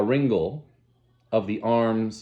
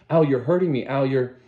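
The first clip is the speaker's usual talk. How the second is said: frightened cry; 5 semitones higher, 5 dB louder than usual. Acted. Lenina hurt and frightened.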